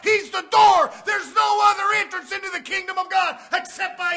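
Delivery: loud, high fire-and-brimstone preaching; preaching shout. Acted. Preacher shouting to the church about Canaan. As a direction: thunder it at the congregation.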